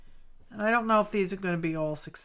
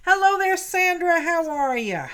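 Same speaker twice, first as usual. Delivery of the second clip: high, loud bright hello; cheery greeting; 10 semitones higher, 10 dB louder than usual. Real, not acted. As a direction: call out a welcome. Greeting a viewer by name.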